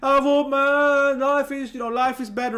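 mocking leftist friends' Facebook celebration, high and loud; mock celebrating. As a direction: gush like an overjoyed activist. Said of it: "They were like".

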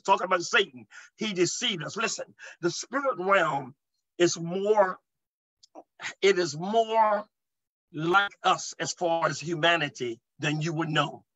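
normal talking voice

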